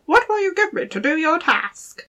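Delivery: high squeaky little goblin voice; gravelly sly hobgoblin. Acted. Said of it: "the dwarf ... said".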